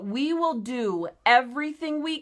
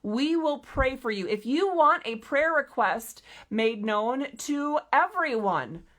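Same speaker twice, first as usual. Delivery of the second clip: ordinary delivery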